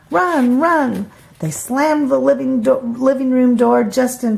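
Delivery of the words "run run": urgent cry. Acted. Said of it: "cried Melissa".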